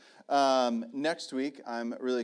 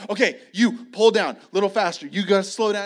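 loud, raised voice giving quick instructions; coaching orders; 6 semitones higher, 8 dB louder than usual. Retold or imitated. Dad instructing his boys with the rods, loud coaching. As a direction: direct the kids like a busy coach.

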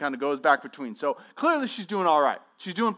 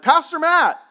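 calling out; 11 semitones higher, 10 dB louder than usual. Retold or imitated. Stranger calls out to pastor.

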